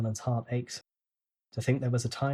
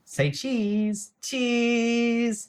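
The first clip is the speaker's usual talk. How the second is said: high, teasing singsong for a photo; playful sister; 11 semitones higher, 4 dB louder, much slower than usual. A narrator's reading. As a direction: tease him while he takes the photo. Sister teasing her brother.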